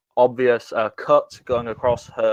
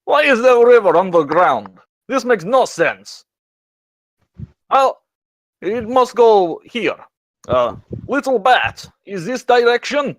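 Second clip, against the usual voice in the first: familiar character voice; 10 semitones higher than usual. Acted. Lost character calling to a little bat, puzzled character voice. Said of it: "a very familiar voice going".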